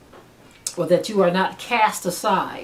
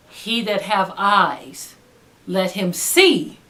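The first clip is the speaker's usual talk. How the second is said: slow; much slower than usual. Acted. Scripture echo slowed down.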